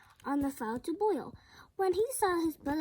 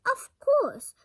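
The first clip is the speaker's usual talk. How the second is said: cheerful farmer; 10 semitones higher, 9 dB louder than usual. A narrator's reading. Farmer answers pig.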